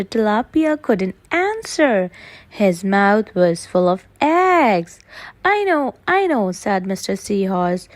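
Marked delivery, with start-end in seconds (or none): 5.4-6.5 character voice. Acted. Seahorse voiced high.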